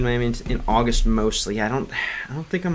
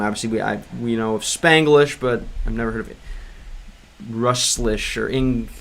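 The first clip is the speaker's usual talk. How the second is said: normal talking voice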